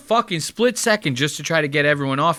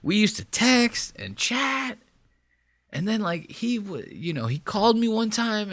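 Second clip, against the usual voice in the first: mock Joel; 7 semitones higher, 5 dB quieter than usual. Imitating Joel inventing a memory, higher and softer. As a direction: stall and bluff through the story. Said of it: "He goes".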